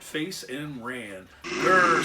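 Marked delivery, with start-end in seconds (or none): 1.4-2.0 short, loud bear growl; forceful animal snarl. A narrator's reading. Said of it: "said the bear".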